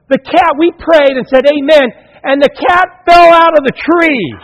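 high, loud triumphant shout; excited kids. Retold or imitated. Kids tell the pastor the miracle, shouting high. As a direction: shout the good news like thrilled kids. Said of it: "They go".

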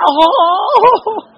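high shouted yelp of pain; mock yelp of a hurt child; pained cry. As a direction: cry out like a kid who just got whacked. Son hit by jump rope.

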